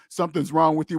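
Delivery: normal talking voice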